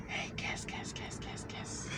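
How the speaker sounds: hushed, insistent repeated whisper; whispered calling. Acted. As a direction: whisper like tempting food calling out. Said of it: "calling your name".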